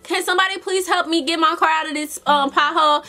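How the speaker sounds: high, fast, desperate begging, imitated; mock pleading for help. Stuck in a pothole, begging passersby for help. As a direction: plead with everyone around, hammed-up.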